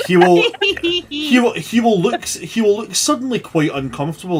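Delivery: flustered stammer. Real, not acted. GM panics in character.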